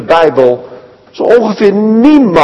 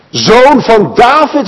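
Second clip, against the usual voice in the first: higher, slowed call; 7 semitones higher, 3 dB louder, slower than usual. Acted. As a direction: cry the title out across the road. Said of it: "roept hij". (hoger, langzaam uitgeroepen)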